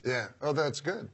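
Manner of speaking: normal talking voice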